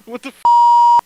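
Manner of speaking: high, loud startled squawk; shocked outburst. Acted. Caught off guard, blurting it out in shock. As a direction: jump out of your skin mid-word.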